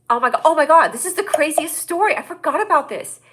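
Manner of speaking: high, loud burst of excitement; excited recollection. Real, not acted. Telling viewers the craziest story she forgot, excited. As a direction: blurt it out, thrilled to remember.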